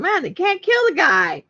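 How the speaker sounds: high-pitched joking quip; playful high mock exclaim. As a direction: toss it off as a high joke. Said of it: "I was joking about".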